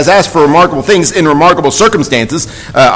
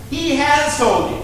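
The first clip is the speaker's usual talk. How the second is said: slow and higher, leaning on "he has"; emphatic He has; 8 semitones higher, 6 dB quieter than usual. Shown as a demonstration. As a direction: press home "he has", softly and slowly. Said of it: "I wanna emphasize that word".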